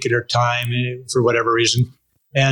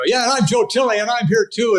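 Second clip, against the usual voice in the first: higher, hammed-up sidekick chiming in weakly; sheepish mock intro; 10 semitones higher than usual. Joking he'd be the useless co-host, mock sheepish sign-on. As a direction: play the useless co-host who adds nothing. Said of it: "and I go".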